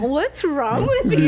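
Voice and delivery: slow, laughing fake worry; laughing mock concern. Wife retelling her fake concern, laughing. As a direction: tease him with fake worry. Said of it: "I was like".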